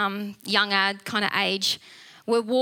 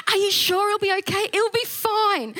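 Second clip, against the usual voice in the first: worried ladies; 8 semitones higher than usual. Retold or imitated. Worried ladies and her reply.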